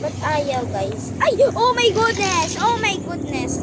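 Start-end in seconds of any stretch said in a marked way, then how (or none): 1.2-3.6 high, loud panicked cry; panicked shout. Real, not acted. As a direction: shout in alarm as you fall. Panic as she falls.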